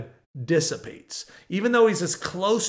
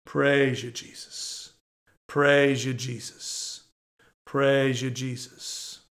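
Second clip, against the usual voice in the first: low, soft prayer, dying away on the name; quiet, repeated worshipful aside; hushed praise; 4 semitones lower, 5 dB quieter, much slower than usual. Real, not acted. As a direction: murmur it low, fading almost to a whisper. Quiet praise after the whoop.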